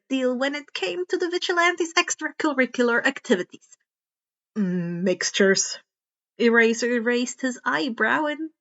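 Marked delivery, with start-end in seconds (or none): 4.5-5.8 lightly lowered tired man's voice; a hint of a weary pro hero; gruff hero. A narrator's reading. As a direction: read him a bit lower and dry.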